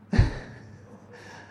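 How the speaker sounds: breathy laugh at her own joke; light unvoiced laugh. Real, not acted.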